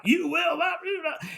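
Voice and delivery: high, loud mock yell of the director; retold yell. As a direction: shout it right in his ear. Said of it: "coming towards me yelling".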